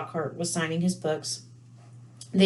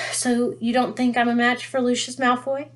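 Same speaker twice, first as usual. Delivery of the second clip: higher, a touch louder, mildly affronted; indignant; 6 semitones higher, 3 dB louder than usual. A narrator's reading. Husband objecting to his wife's warning, mildly indignant. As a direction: read it as a lightly voiced offended husband. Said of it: "said Mister Weasley indignantly".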